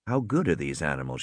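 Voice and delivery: low, hushed inner thought; quiet, low-pitched musing; wondering to herself. A narrator's reading. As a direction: wonder it softly, privately. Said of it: "she wondered".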